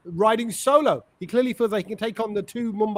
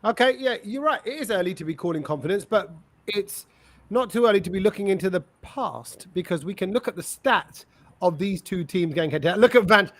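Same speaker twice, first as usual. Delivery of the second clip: ordinary talk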